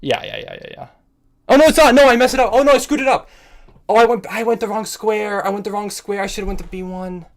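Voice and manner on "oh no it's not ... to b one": high, loud wail of regret; real anguished dismay. Real, not acted. Chess player realising the wrong square, anguished dismay. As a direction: wail as the game slips away.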